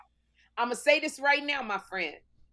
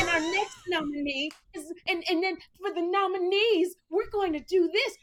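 high, affected presenter mimicry; Kim; 5 semitones higher than usual. Friend showing how Kim presented nominees, mocking impression. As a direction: imitate your friend announcing in a put-on high voice. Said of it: "why's she talking like that?".

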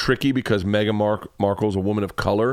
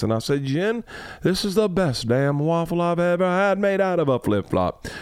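imitating old Southern accent; 6 semitones higher than usual. Retelling Georgia's reading to friends, lightly voiced old Southern accent. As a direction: tell the silly story as Georgia's Southern Scout. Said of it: "do Scout's voice in an old Southern accent".

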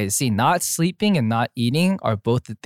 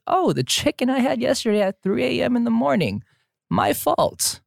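high-pitched sheepish realization; high mock realization; 10 semitones higher than usual. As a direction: squeak it out like a guilty snacker. Realizing cause, mock regret.

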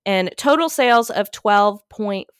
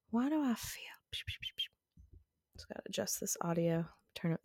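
whispered aside; 2 semitones lower, 19 dB quieter, faster than usual. Real, not acted. Muttering while fixing audio.

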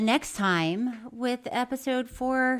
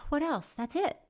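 normal talking voice

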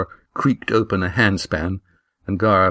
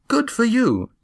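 "Good for you" lifted much higher, admiring; proud praise. A narrator's reading. Gar praising Eva's climb, high admiring praise. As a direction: cheer her on up high. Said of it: "he said firmly".

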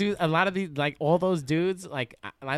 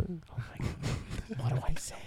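hushed, acted inner panic; soft, nervous thinking aloud; mock panicked whisper; 7 dB quieter than usual. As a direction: whisper it to yourself as if stuck for words. Acts out panicked inner thoughts.

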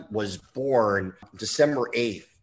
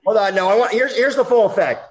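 excited; 9 semitones higher, 7 dB louder than usual. Real, not acted. About to blow nose, laughing.

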